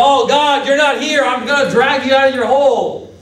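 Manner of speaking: high, urgent retold outcry; agitated plea. Saul calling on God as the Philistines come, agitated plea. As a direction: push it out high, like frantic pleading.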